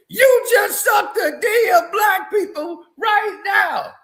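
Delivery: hammed-up shouting imitation of him on the mic; mocking shouted quote. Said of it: "You just got on the mic and said".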